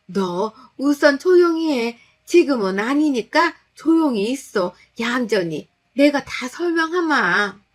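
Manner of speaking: slow, loud, high stern elder voice; stern old mother-in-law. Acted. Mother-in-law silencing the wife, slow stern command.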